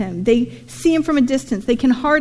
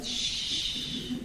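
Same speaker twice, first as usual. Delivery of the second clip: unvoiced shush; hushing; 14 dB quieter than usual. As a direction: hush them urgently. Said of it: "I'd be like".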